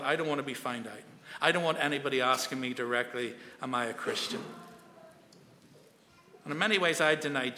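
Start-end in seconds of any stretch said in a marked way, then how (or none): 0.0-4.4 a soft, low murmur of remembered fear; half to himself, anxious; fearful inner voice. Retold or imitated. A schoolboy afraid of being found out, soft and low. Recalling own fearful thoughts.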